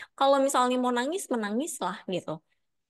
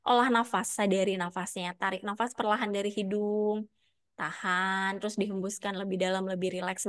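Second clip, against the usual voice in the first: guided breathing; 2 semitones lower than usual. Acted.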